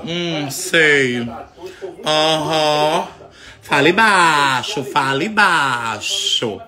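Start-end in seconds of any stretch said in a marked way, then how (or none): 3.6-6.6 slow, stretched command; long-vowelled telling-off; drawn-out hushing. Real, not acted. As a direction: drag out each word to quieten the dog. Tells barking dog to hush.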